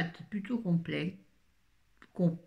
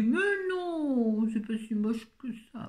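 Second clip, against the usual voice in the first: high, drawn-out mock reassurance; exaggerated husband impression; 7 semitones higher than usual. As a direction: ham up a partner's protest, swooping up high. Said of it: "il dit pas ça comme ça". (imitation exagérée du mari, voix aiguë)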